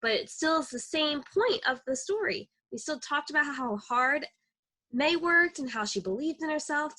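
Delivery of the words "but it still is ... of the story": ordinary delivery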